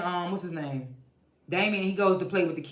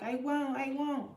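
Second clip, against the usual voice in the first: much higher and softer, imitating the daughter; high, soft assurance; 8 semitones higher, 6 dB quieter, slower than usual. Daughter brushing off her dad's warning, high soft mimicry. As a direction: lightly mimic a soft, high-pitched promise. Gemma's reply to Marcus, retold.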